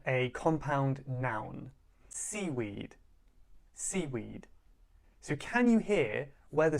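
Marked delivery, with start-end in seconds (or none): 2.1-3.0 "seaweed" with the beat on SEA; lower, softer demo, SEA stronger than weed in "seaweed"; stress on first syllable. Shown as a demonstration. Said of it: "It's on the first syllable". 3.7-4.5 "seaweed" with the beat on SEA; lower, softer demo, SEA stronger than weed in "seaweed"; stress on first syllable. Shown as a demonstration. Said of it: "It's on the first syllable".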